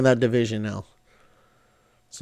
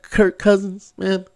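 ordinary talk, nothing marked

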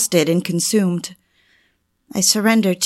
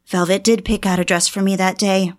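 ordinary delivery

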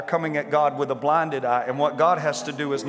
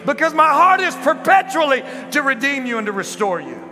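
high, loud impassioned preaching; preaching at full force; 10 semitones higher, 5 dB louder than usual. Real, not acted. Preacher to the congregation, loud impassioned preaching. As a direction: preach with rising fervour.

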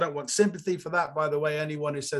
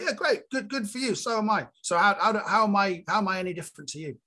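sarcastic retort; 6 semitones higher than usual. Retold or imitated. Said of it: "And I'm like".

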